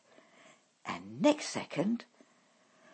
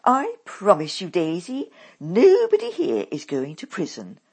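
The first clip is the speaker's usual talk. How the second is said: louder, curt mother cutting her off; snappy; 3 semitones higher, 11 dB louder than usual. A narrator's reading. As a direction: snap back dismissively. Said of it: "comes the snappy answer".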